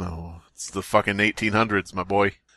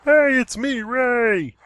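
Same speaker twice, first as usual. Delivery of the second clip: much higher, chirpy character voice; goofy high cartoon voice; 11 semitones higher, much slower than usual. Voicing the ray of light as a joke, goofy high. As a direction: pop in as a silly cartoon ray.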